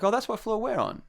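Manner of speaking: high mock pleasant surprise; imitated delighted girls. Girls find they share his floor, high imitated delight. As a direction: lift the voice high for their discovery.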